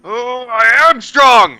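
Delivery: loud, high, slow protest; indignant boast. Acted. Hero answers Tabby's taunt with a loud, high, stretched-out boast. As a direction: draw it out, wounded pride. Offended hero insists he's strong.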